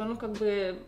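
ordinary talk

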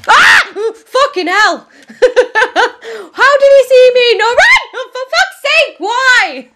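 high, loud laughing outcry; real frustrated yelling. Real, not acted. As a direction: yell in shocked, laughing frustration. Killed suddenly in gunfight.

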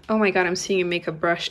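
usual speaking voice

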